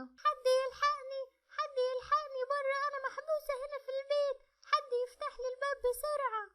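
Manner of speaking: put-on high, loud little girl's cry for help; a child locked inside shouting to be rescued; trapped little girl. (بنت صغيرة محبوسة بتنادي بصوت عالي عشان حد ينقذها)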